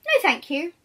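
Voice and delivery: loud, bright little refusal; a cheerful no from inside; little creature. Acted. Creature refusing the wolf again, loud. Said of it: "replies the little creature".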